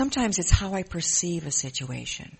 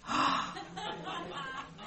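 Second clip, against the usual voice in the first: sharp gasp; 13 semitones higher, 7 dB quieter than usual. Retold or imitated. Realizing medication causes weight gain.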